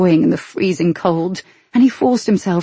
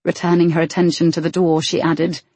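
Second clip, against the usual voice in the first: ordinary delivery, nothing marked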